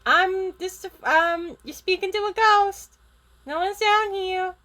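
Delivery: high, insistent little voice; Gizmo denying it. Acted. Gizmo tells the principal no one's there, high-pitched bluffing.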